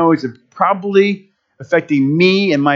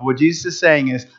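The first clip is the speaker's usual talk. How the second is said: ordinary talk, nothing marked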